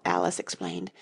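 usual speaking voice

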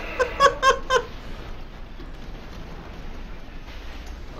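wheezing silent laugh; laughing out of breath; airy helpless giggle; high breathless laughter. Real, not acted. Laughing after mock explosion.

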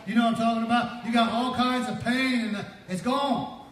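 high, building to loud preaching; fervent preaching. Real, not acted. Preacher to congregation on pain being gone, fervent preaching. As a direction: drive it up to a shouted release.

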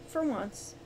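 usual speaking voice

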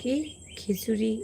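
ordinary delivery

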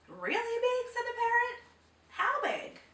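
high, squawky parrot asking. A narrator's reading. Parrot questioning the frog, high and eager.

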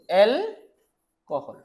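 Alcohol split as এল, long pause, কহল; broken into parts. Shown as a demonstration. Teacher sounding out the new term for students, slowly broken up. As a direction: draw out alcohol in two separate parts.

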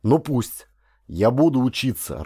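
plain reading voice

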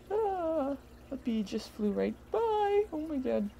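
sudden high yelp of alarm; jumpy, spooked by a bee; startled. Real, not acted.

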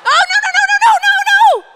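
very high, loud shouted no no no; horrified shout. Retold or imitated. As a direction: scream it in mock horror, laughing through. Horrified reaction to being Filbert.

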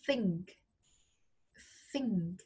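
"Think" with air pushed between the teeth; tongue between teeth, breathy th in "Think"; airy English th. Shown as a demonstration. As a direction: blow air through the teeth to start "Think". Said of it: "Để gió lùa ra răng".

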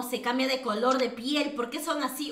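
ordinary talk

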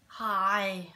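normal talking voice

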